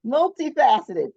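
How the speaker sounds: ordinary delivery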